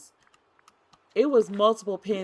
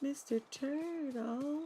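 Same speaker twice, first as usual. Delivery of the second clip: high, soft sing-song call; 6 semitones higher, 6 dB quieter, slower than usual. Real, not acted. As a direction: call out playfully to a lost pet. Calling out for a turtle.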